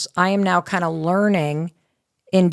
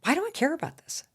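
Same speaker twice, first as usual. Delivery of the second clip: inner thought; 8 semitones higher, 8 dB quieter, faster than usual. Retold or imitated. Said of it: "and I thought".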